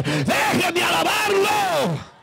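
high, long-drawn preacher's cry; ecstatic shout. Real, not acted. Worshipper begging to be allowed to praise, ecstatic shout. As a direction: stretch it out slowly into a soaring cry.

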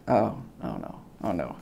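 low, soft mock dread; a hushed oh no; quiet dread. Dreading the nonsense page.

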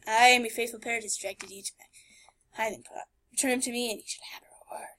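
unvoiced hushed whisper. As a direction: read it in a hush. Whispered reading of NPC.

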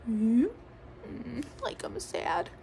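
soft mock sad; sad pillow. Shown as a demonstration. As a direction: drop into a little sad murmur. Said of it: "Can you make a sad pillow".

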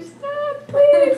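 "Stop, please" in a tiny high squeal; high squeaky plea. Retold or imitated. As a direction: beg them to stop in a squeaky voice, half laughing. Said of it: "and you're like".

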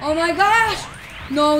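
ordinary delivery

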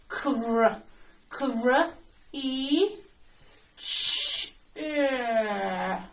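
"Cr-, cr-, E, ch-, er" in separate sounds; sounding out. Shown as a demonstration. Teacher sounding out creature to spell it, phonics style. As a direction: segment each sound of "creature", with gaps.

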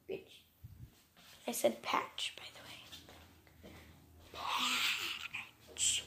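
sheepish whisper. Real, not acted. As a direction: whisper the cover-up. Whispered correction.

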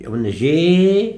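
emphatic teacher model; stressed model sound. Shown as a demonstration. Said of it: "هنا بقى إيه؟".

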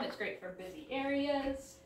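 ordinary talk

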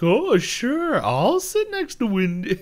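high, loud mock-teasing singsong. Sister needling her brother about his crush, teasing. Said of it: "Mabel begins teasing".

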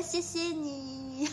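slow, giggly thank-you; drawn-out thanks melting into laughter; laughing thanks. Real, not acted. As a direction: thank them through a giggle. Thanks viewer for compliment.

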